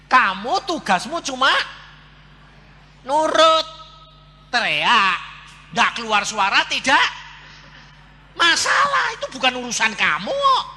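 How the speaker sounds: loud, high, punched-out phrases; insistent scolding. Acted. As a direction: hammer the lesson home at full voice. Insistently instructing her to obey.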